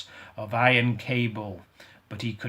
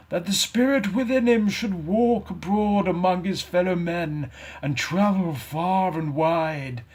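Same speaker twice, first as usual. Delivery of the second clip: mournful ghost; 6 semitones higher, 3 dB louder than usual. Acted. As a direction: grieve for the wasted life. Ghost lamenting its fate.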